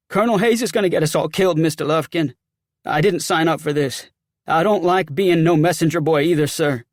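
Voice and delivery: loud, agitated soldier complaining to his superior; aggrieved, nervy soldier; rattled sergeant. Acted.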